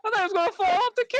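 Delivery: jumped way up, fast and high; squealed repeat. Acted. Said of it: "repeated with pitch jump".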